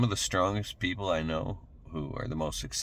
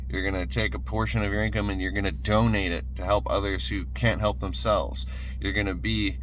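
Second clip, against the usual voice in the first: pumped-up sermon, louder and higher; rising motivational preaching; 3 semitones higher, 3 dB louder than usual. Acted. Motivational speaker urging viewers to act, fervent preaching. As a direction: fire them up like a preacher on a roll. Rallying viewers to change their lives.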